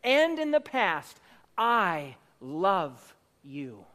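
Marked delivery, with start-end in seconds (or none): 1.5-3.9 lower, very slow and intimate; tender reassurance. Retold or imitated. Preacher assuring the church of God's love, low and slow. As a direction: say it like a promise to one person. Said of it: "God could not say of you".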